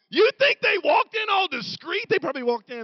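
high, loud preaching building to a shout; full-force preaching. Real, not acted.

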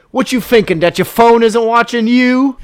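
loud, high-pitched hammed-up British accent; mock an alarmed question in broad British. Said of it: "only British accent I can do".

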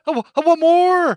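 loud, high-pitched whiny yell; mock demanding wail. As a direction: beg like an over-the-top kid.

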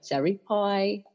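slow, light Brooklyn vowels on "Cherry pie"; Brooklyn accent. Retold or imitated. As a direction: mom reading, lightly Brooklyn. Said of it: "she says things like".

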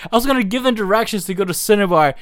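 ordinary delivery

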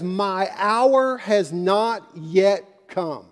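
slow, deliberate emphasis; weighty slow line. Shown as a demonstration. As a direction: let each word land. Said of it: "something very, very interesting".

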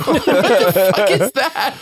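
slow laughing question; laughing disbelief. Real, not acted. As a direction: laugh through the words in disbelief. Mocking silly president names.

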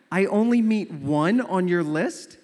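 loud, slow incredulous question; mock outraged disbelief. Suitor learning he meets only one item, mock outrage. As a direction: play it wounded and appalled. Suitor's affronted reaction to the answer.

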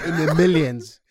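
high, shouted punchline over laughter; loud emphatic repeat. Real, not acted. Co-host repeating inflation joke, loud and emphatic. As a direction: hammer the phrase home at full volume.

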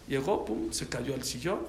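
ordinary delivery

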